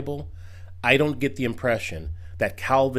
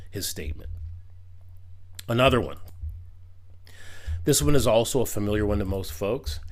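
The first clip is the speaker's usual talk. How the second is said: usual speaking voice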